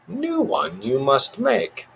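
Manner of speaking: high, slow creature voice; Yoda puppet voice. A narrator's reading. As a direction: pronounce it slowly like an odd little sage. Finger puppet Yoda gives advice.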